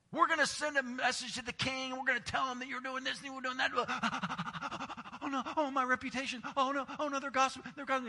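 high, fussy panicky voice; mock the gossips in a high fret; mock enemies. Pastor mocks Nehemiah's enemies, high and fretful.

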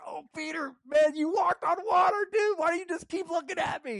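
high, loud, buddy-coach voice; Jesus cheering Peter on like a coach. Retold or imitated. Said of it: "He's coaching him".

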